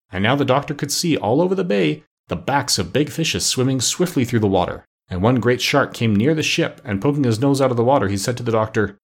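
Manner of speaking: ordinary delivery, nothing marked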